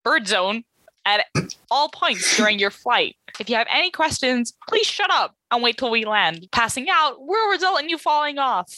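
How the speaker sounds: breezy in-flight safety spiel; flight attendant announcement. Acted. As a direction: rude cabin crew reading the rules.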